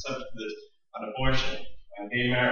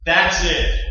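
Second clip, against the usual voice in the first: God's stern voice, raised and loud; stern outburst; 5 semitones higher, 8 dB louder than usual. Acted. God's loud stern voice, fed up with his people. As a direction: declare it like an angry God at the end of patience.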